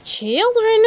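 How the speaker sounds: high, loud call to the class; Madame Gazelle calling. A narrator's reading. Teacher calling her class, high and loud.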